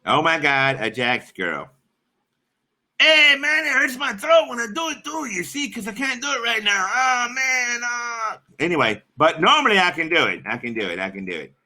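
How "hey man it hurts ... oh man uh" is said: high, strained stoner character voice; Cheech. Acted. Streamer struggling through his signature impression, high Cheech voice. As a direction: push the pitch way up, whiny stoner. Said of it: "which is my version of Cheech".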